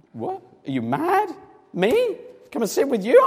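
can't believe what you're hearing; high incredulous what, me?; astonished disbelief. Pretend. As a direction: pitch jumps way up in disbelief. Listener stunned by the Queen's invitation.

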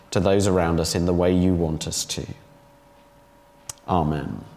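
low, hushed prayer to the Father. Acted. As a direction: pray it humbly, bringing the voice down. Leads congregation in prayer.

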